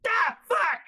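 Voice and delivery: a quick hammed-up yelp of pain; mock pain yell. Imagining his own bee sting, one sharp yell. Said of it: "I'd be like".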